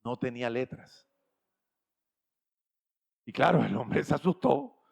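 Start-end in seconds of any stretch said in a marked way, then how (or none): none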